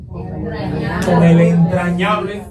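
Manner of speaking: "entrañable" drawn out slowly and weighted; slow emphatic "entrañable"; stress on entrañable. Shown as a demonstration. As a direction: linger on "entrañable", each syllable slow. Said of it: "¿Con qué dice?".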